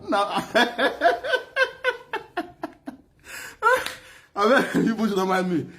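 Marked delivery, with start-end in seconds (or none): 0.5-2.9 very high long laughing cackle. Real, not acted. Cackling at his own story. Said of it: "when I laugh hard like this".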